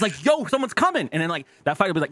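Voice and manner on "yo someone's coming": high, urgent hissed-free warning; a lookout warning about teachers; mock warning call.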